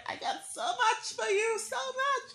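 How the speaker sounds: squealing high with a laugh; excited. Real, not acted. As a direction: gush it out, giddy and high. Host thrilled greeting viewers.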